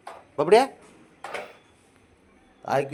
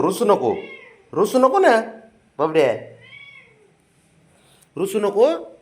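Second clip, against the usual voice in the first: coaxing pet talk; 7 semitones higher than usual. Real, not acted. Owner coaxes his sulking dog not to sulk, high sing-song baby talk. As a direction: coax a sulking pet to cheer up.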